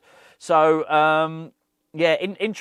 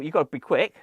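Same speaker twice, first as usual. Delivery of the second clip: usual speaking voice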